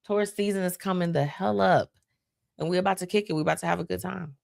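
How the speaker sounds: normal talking voice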